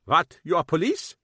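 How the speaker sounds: a German man caught off guard; startled German shopkeeper. Acted. Kappelhoff taken aback.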